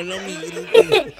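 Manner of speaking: high, slow, laughing through the punchline; laughing echo of the joke's last line; laughing repeat. Real, not acted. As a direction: repeat it while cracking up. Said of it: "repeats punchline laughing".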